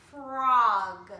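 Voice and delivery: Frog called out very loud; loud blended word. Shown as a demonstration. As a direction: announce Frog proudly. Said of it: "Just like in dog".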